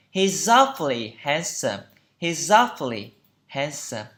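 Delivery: careful teacher's delivery of He's awfully handsome; pronunciation model. Shown as a demonstration. Teacher models phrase for learners.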